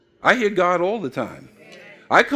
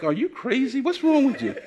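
higher, amused disbelief; father reacting to his children's formality, higher and amused; mock incredulous; 7 semitones higher than usual. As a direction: act baffled at your kids, laughing.

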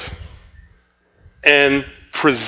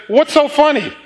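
high-pitched mock challenge; indignant question; 10 semitones higher than usual. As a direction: retell it as a much higher, put-out question. Acted challenge in laughing quarrel.

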